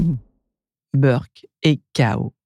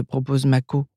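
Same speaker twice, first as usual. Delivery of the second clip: plain reading voice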